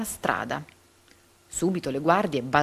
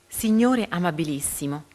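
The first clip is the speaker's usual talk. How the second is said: usual speaking voice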